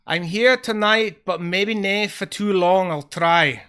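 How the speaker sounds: loud, hammed-up Scottish greeting; bad Scottish accent. Streamer greeting a viewer, bad Scottish accent. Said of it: "Bad Scottish accent".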